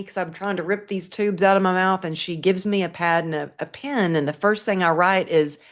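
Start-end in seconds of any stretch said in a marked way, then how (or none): none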